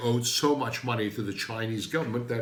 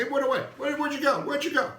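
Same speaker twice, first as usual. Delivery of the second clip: much higher, singsong mock lament for the lost accent; high mock wail; faster than usual. Vlogger mourns his vanished English accent, high mock wail. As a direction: call after the lost accent in a high plaintive voice.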